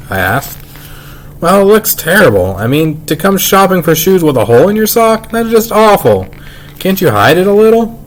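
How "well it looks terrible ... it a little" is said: a fussy mother, voiced higher; worried mother, pitched up, lightly played; fussing mother. Mother fusses over hole in sock.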